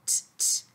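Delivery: "T, t" whispered puffs; short breathy "t" sounds; letter sound t. Shown as a demonstration. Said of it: "the T says t".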